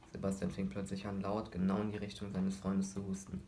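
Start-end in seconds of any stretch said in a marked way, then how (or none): none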